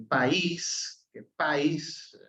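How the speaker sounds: país first as PA-is, then as pa-ÍS; país said twice, slowly, the stress moving from A to Í; contrasting stress. Shown as a demonstration. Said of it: "no es lo mismo".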